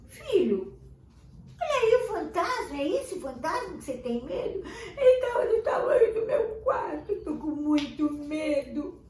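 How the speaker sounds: put-on high, loud, frightened child crying out; a small boy panicking about his ghost; scared child. Criança assustada grita para os pais.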